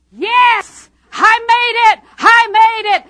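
very loud, high cry of victory; triumphant shout. Acted. Mama rejoicing up in heaven, triumphant shout. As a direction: yell it like someone who just reached glory.

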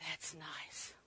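very quiet, low murmur; frail aunt's soft reply. Retold or imitated. Precious lady with Alzheimer's answering her visiting niece, softly.

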